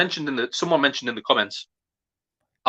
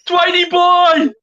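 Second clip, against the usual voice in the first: slow, stretched high shout; goal celebration; 6 dB louder, much slower than usual. Real, not acted. Fan hails the number twenty after his goal, drawn-out shout. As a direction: drag the cheer out long and loud.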